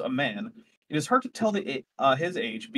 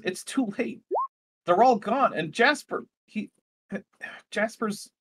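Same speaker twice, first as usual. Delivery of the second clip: higher, voice breaking into grief; on the edge of sobbing, sighing; grief-stricken; 7 semitones higher than usual. Acted. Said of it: "and then she just breaks down sobbing".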